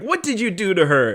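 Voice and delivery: mock fury at the captor; pissed demand. Caroline confronting the man who hurt her mother, angry. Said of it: "Caroline is pissed".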